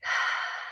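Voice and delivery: soft, long sigh out; demonstrated deep exhale. Shown as a demonstration. Telling viewers to take a breath, soft exhaling sigh. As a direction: let the breath go audibly. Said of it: "Take a breath".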